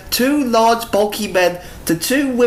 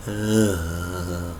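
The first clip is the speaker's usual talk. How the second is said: ordinary delivery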